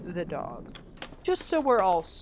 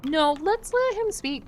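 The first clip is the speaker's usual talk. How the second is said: high-pitched, raised character voice; high put-on voice; 12 semitones higher, 6 dB louder than usual. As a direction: pitch it way up and loud. Acting a character in game.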